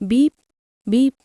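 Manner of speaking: two quick rising beeps like a bike horn; playful horn beep. A narrator's reading. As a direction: imitate a scooter horn. Imitating the horn.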